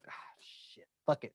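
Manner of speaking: whispered, giving up; whispered resignation. Retold or imitated. Telling his friend how he gave in, whispered. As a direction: breathe it out like a quiet surrender. Retelling giving in, taking more.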